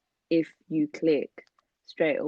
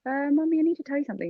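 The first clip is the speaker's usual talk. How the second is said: high, louder, hesitant opening to mum; retold nervous confession; 11 semitones higher, 4 dB louder, faster than usual. Child about to confess to mum, acted nervous plea. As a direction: imitate a nervous child owning up. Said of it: "I was literally sweating".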